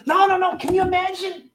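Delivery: ordinary talk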